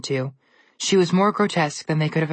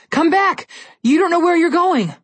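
shouting; 13 semitones higher, 5 dB louder than usual. Acted. Brother chasing sister into woods.

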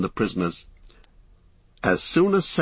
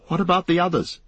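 high, anxious hobbit; Frodo, concerned; 8 semitones higher, faster than usual. Acted. Frodo asking Sam after their friends, high and concerned. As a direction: pitch it up with concern.

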